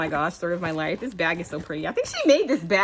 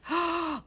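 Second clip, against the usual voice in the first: soft delighted gasp; 9 semitones higher, 6 dB quieter than usual. Real, not acted. Seeing a purse charm.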